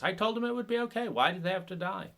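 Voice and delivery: high, fast mock outrage at the dying; mock indignation. The helper who said it would be okay, in mock outrage. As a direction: act wounded that they dared to die.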